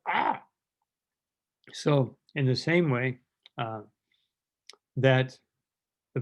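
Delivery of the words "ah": high, squawking bird cries; panicked parrot squawk. Pretend. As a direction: shriek like a frightened parrot at the cat. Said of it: "the parrot will go".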